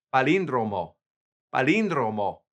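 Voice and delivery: palíndromo, stress on the í; palíndromo with the accent on the í; Spanish pronunciation. Shown as a demonstration. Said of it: "you've got that accent on that I".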